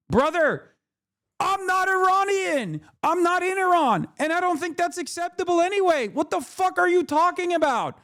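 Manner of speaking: high-pitched, indignant outburst; real exasperation, pitch pushed well up; exasperated rant. Real, not acted. Exasperated rant at a viewer's comment.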